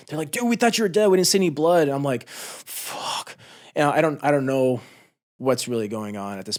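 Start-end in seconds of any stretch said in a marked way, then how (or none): 0.3-1.8 higher and louder, disbelieving; excited call. Retold or imitated. Teammates calling to the soldier they thought was dead, loud and higher. 2.3-3.4 breathy under-the-breath swear; whispered curse. Retold or imitated. Soldier stunned after waking up, whispered curse. Dazed reaction after coming to.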